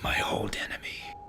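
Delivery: hushed, ominous aside; whispered realization; dramatic whisper. Acted. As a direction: breathe it out as a dark revelation. Recognizing the villain's voice.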